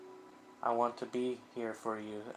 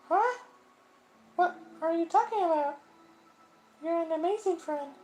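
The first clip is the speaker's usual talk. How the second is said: put-on much higher, louder girl's voice, surprised; a sweet high voice pushing back kindly; high girlish reassuring voice; Sayori's voice; 9 dB louder than usual. Voicing Sayori.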